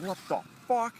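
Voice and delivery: high-pitched retold startled reaction; acted startled yelp of words. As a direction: act out being caught off guard, pitch jumping.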